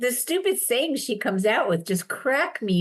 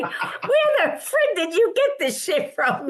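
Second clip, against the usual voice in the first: higher, drawn-out retold disbelief through laughter; laughing hard; 6 semitones higher than usual. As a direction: crack up while demanding an answer. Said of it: "I was crying so hard".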